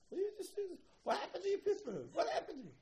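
high, loud mock old-man squeal; grandfather scolding. Grandfather scolding grandson over chopsticks, high loud squeal. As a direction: shriek out the disbelief.

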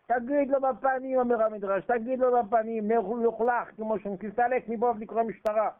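high, loud demand to say it to his face; loud urging. Acted. Preacher urging Jacob to tell Laban off, loud. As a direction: push him to speak up, forceful.

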